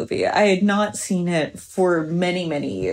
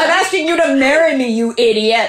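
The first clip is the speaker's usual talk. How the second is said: quick, louder, higher exasperated mock Maxim; impatient proposal; 4 semitones higher, 4 dB louder, faster than usual. Imitating Maxim proposing from the bathroom, impatient and brusque. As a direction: toss it off like an annoyed husband, half laughing. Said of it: "he's brushing his teeth and he says".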